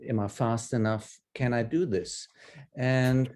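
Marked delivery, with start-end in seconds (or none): none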